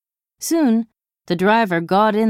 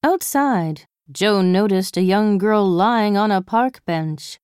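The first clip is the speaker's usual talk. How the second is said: ordinary delivery, nothing marked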